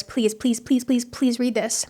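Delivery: soft, repeated plea; pleading. Real, not acted. Host begging listeners to read the book, softly pleading. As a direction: beg gently, drawing out the last pleases.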